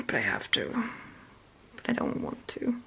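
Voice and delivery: whispered, soft and unvoiced; grudging whisper to herself; reluctant whisper. Real, not acted. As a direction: whisper it like a sulky confession. Reluctant about uni work.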